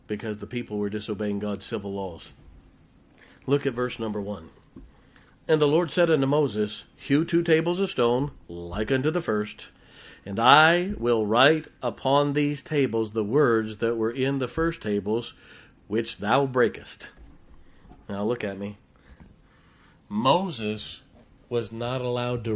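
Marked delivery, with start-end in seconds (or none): 5.5-17.1 louder, measured King James reading; scripture reading. Acted. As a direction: proclaim the verses to the congregation. Reads scripture aloud.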